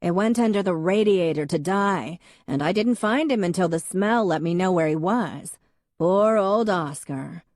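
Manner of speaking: put-on a loud lady mourning her old cat; booming voice. A narrator's reading. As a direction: boom out the sad pet tale. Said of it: "in her booming voice".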